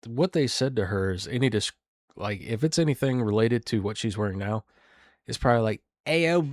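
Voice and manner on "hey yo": high mock-Philly accent; bad Philly accent. Guessing at what was said to her, bad Philly accent. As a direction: attempt a rough Philly accent, pitched up. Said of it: "I can't do a good Philly accent".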